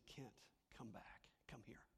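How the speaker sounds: a whispered, gentle call; tender whisper. Acted. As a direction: whisper it like a loving call home. Jesus calling back the sinner.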